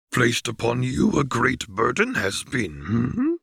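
put-on old Jedi grandmaster addressing the council, thoughtful; Yoda voice. A narrator's reading. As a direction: wise little master, musing kindly.

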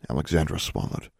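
usual speaking voice

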